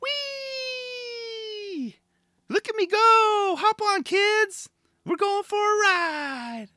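put-on very high, slow, squealing kid-on-a-ride voice; giddy Clive. Clive shouting to kids on the rail, high slow squeal. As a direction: squeal it like a child on a merry-go-round. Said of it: "He's like".

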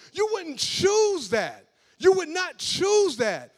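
high, loud preacher building to a cry; heated preaching. Real, not acted. Preacher challenging the congregation, loud heated preaching. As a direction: hammer the question home.

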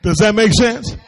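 loud, high-pitched, driving sermon; preaching at full force. Real, not acted. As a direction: preach it out hard to the room.

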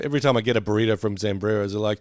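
ordinary delivery

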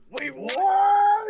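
stunned loud squawk; shocked. Retold or imitated. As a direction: yell it like the whole chat just freaked out. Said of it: "Everybody in the chat was".

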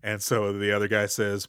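ordinary delivery

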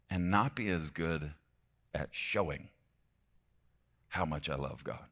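soft, low and halting; subdued confession. Real, not acted. Preacher admitting he falls short at showing love, subdued and soft. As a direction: drop to a quiet admission, with pauses. Confessing failure after talk of communicating.

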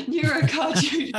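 word breaking into laughter; laughing at a stranger's rude question; laughing through it. Real, not acted. Speaker laughs telling stranger her condition, laughing.

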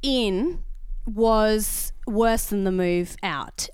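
very slow, drawn-out complaint; slowed right down for weight; slow emphasis. Real, not acted. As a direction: stretch every word out.

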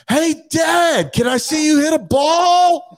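very high mock child's yell; imitated kid screaming. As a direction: holler for Dad's attention in a kid's voice. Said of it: "screaming it".

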